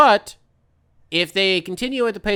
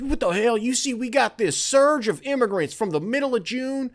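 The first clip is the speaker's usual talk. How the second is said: higher, mocking candidate ranting at a town hall; mock Republican candidate; 8 semitones higher than usual. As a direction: rant in a mocking voice about immigrant surges. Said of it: "do you think they'll be like".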